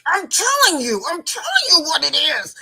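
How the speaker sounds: high, loud insistence; exasperated retold shout, hitting "telling"; frustrated yelling. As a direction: imitate a student at the end of patience. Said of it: "frustrated, and she's like this".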